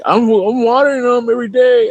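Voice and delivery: high, loud defensive dad. Retold or imitated. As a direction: pitch way up as the insisting father. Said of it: "it's like".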